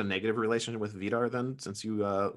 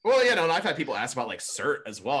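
normal talking voice

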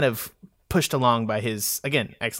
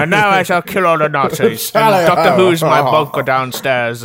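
mock heroic Churchill; 5 dB louder than usual. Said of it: "he was just like".

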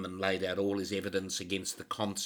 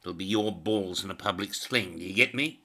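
louder, hammering warning to a subordinate; angry threat; 2 semitones higher, 7 dB louder than usual. Acted. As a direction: threaten him, jab every line.

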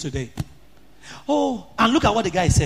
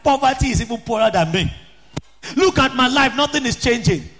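angry complaint; 5 semitones higher than usual. Retold or imitated. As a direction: rail at the father, voice raised. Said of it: "He was so angry".

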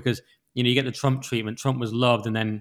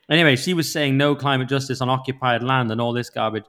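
ordinary delivery

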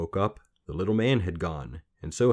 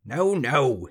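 high, loud, snappish little man; cross refusal; 7 semitones higher, 6 dB louder than usual. A narrator's reading. Little man rejecting a guess, cross. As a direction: reject it with a stamp of temper.

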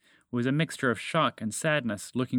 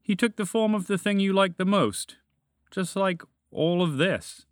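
explaining dragon; 7 semitones higher, 3 dB louder than usual. A narrator's reading. Dragon explains chocolate spread to moose, raised knowing voice.

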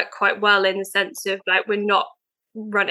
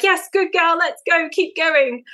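high-pitched, upbeat cheering on; encouraging dog handler; 8 semitones higher, slower than usual. Retold or imitated. Runner urging her young dog onward, high encouraging voice. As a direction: imitate yourself urging a dog along. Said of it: "encourage her to keep going".

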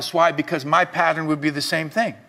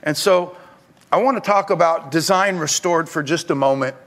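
ordinary delivery

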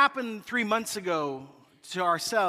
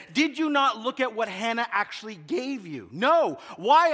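mock quarrel; 5 semitones higher, 5 dB louder than usual. Retold marital quarrel.

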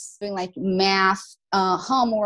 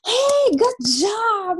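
high, bright cheering for a student; excited teacher praise; cheery praise; 13 semitones higher, 4 dB louder, slower than usual. Retold or imitated. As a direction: cheer them on like a proud teacher.

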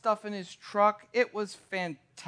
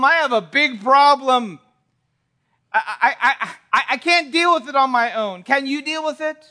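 louder, higher, stammering appeal for help; desperate plea; 6 semitones higher, 8 dB louder than usual. Acted. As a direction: beg as someone out of options. Sinner pleading to Christ.

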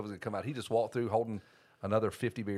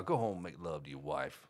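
imitated dad; 6 semitones lower, 5 dB quieter than usual. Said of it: "he told Kyle".

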